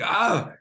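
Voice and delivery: short, high fake cry of pain; a loud faked yelp, played up to fool them; faked scream. Twelve-year-old faking pain so the bullies let go, high faked yelp. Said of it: "like, feign".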